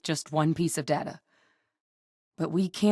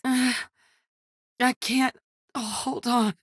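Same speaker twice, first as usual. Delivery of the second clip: strained, higher-pitched effort; struggling; 7 semitones higher than usual. Acted. Fighter who can't go on, struggling. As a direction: struggle to get the words out.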